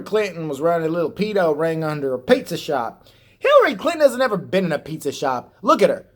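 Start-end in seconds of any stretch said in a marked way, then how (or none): 3.4-6.1 mock rant. Ranting mockery of Hillary.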